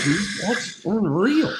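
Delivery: high, drawn-out imitation of being amazed; retold amazement. Retelling own amazed reaction.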